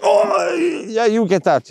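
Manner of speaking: high-pitched mock cry, then giving in; imitated reluctant outburst. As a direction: act out struggling to hand over the rod. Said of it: "I'd be like".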